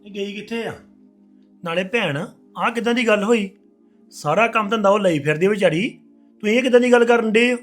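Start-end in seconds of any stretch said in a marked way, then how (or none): 1.6-7.6 louder and quick, indignant; heated protest. Acted. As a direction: push back hard at her. Husband argues with sister.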